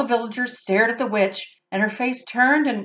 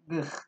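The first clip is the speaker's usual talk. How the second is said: disgusted ugh; 4 semitones lower, 12 dB quieter than usual. Real, not acted. Reader disgusted aside.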